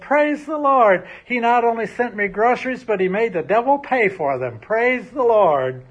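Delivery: rejoice with everything; jubilant. Acted. Old lady clapping and jumping at the neighbor, jubilant shouting. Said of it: "jumping up and down and clapping her hands".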